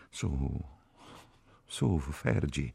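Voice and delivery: soft, slow soothing voice; comforting. A narrator's reading. Catherine consoles weeping Ferguson, comforting softly. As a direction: gently hush a crying friend. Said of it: "la confortò Catherine".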